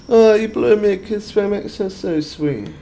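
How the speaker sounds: cutesy thanks. Acted. Thanks viewer for blown kiss.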